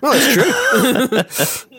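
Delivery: said through a laugh, slow and high. Real, not acted. To friends after a joke, laughing through it. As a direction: laugh the words out.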